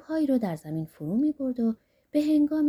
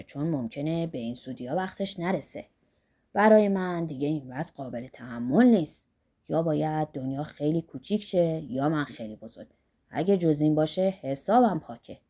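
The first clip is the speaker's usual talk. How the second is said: put-on lightly lowered voice of an eager old man; Zorba's voice; 7 semitones lower than usual. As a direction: rub your hands over the gold, voice dropped low.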